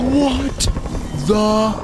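gasp, then high, loud disbelief; real terrified shock. Real, not acted. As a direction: cry out, word by word, in fright. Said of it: "that actually terrified the fuck out of me".

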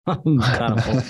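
chuckling through the words, self-mocking; laughing. Real, not acted. Guest jokes to the host about his age, laughing.

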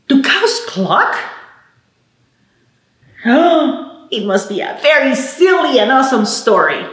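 ordinary talk

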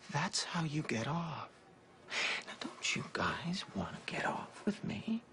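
soft, breathy whisper of obsession; creepy confiding whisper. Acted. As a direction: draw them in with a hushed, unsettling intimacy. Creepy confiding about control.

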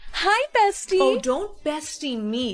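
usual speaking voice

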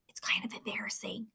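whispered so the friend can't hear. A narrator's reading. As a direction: hush it into the car window. Head in window, hiding from Jack.

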